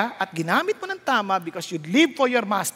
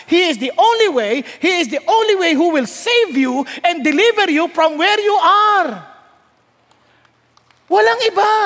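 louder, rising preacher's cry; preaching shout; 5 semitones higher, 5 dB louder than usual. Real, not acted. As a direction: proclaim it with full force. Preacher climaxes on Jesus. (malakas na sigaw ng pangangaral)

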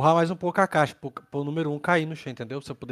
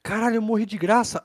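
frustrated shout; 7 semitones higher, 6 dB louder than usual. Real, not acted. Frustrated after dying.

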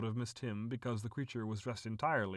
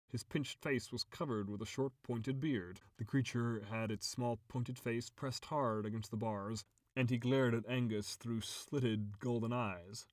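usual speaking voice

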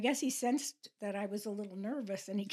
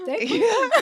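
imitated Russian grandmother, high and loud; Russian accent; 12 semitones higher, 10 dB louder than usual. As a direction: answer loudly with a Russian accent. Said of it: "with her Russian accent".

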